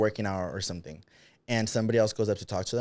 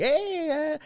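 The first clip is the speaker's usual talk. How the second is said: very high bright hey yeah; mock happy greeting; cheerful gym greeting. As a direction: greet a friend all of a sudden.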